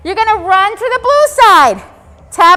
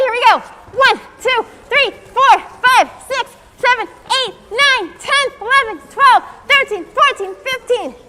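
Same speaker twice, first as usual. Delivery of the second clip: steady upbeat count, a bit higher; workout counting; 4 semitones higher than usual. Acted. Teacher counting climbers for the children, upbeat count. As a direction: drive them up the mountain with the count.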